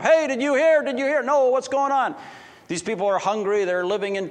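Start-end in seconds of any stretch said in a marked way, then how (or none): 0.0-2.1 high and loud, breathless news; excited calling. Acted. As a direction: burst in calling out. Someone rushes in with news.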